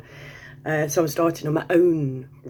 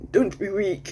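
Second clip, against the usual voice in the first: put-on Libyan accent; 5 semitones higher than usual. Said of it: "I can't do his accent".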